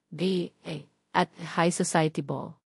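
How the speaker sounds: ordinary talk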